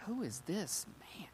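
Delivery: awed stage whisper; very soft, astonished half-whisper; freaked-out hush. Acted. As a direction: whisper it in acted disbelief. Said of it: "That freaks them even more".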